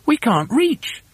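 high, plaintive little protest; high-pitched small complaint; helpless followers. A narrator's reading. Helpers protest they cannot reach.

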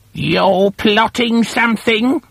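put-on high, slow suspicious old hag; a high crone voice, slow and wary; Missus Twit voice. A narrator's reading. Old wife warning her husband, high suspicious hag voice. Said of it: "Missus Twit said".

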